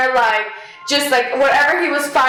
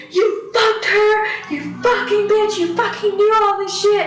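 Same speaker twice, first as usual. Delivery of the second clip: high, furious mock screaming; retold screaming; 7 semitones higher than usual. Friend screaming accusations at his buddy, furious high yell. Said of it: "he's just screaming".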